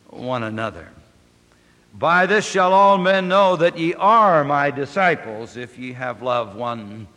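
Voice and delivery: measured, solemn reading of the text; scripture reading. Acted. Preacher reading scripture aloud, solemn. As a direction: read the Gospel to the congregation. Quoting the Lord's words.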